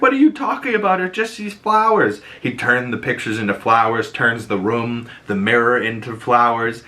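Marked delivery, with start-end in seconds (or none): 0.0-2.2 mock confusion. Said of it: "And he's like".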